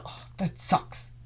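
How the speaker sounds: slow, breathy sigh of a let-down student; disappointed student. Retold or imitated. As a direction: drag out the letdown like a sulky kid. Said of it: "And she's like".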